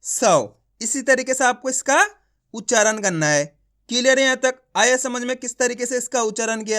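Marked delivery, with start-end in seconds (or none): 0.0-0.6 सौ said with a clear au; pron demo. Shown as a demonstration. Teacher repeats सौ in the drill. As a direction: model सौ. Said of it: "तो सा को कैसे पढ़ रहे हैं?".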